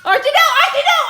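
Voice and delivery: high, very loud yell of no; shouting at dog. Real, not acted. Dog chasing the bird.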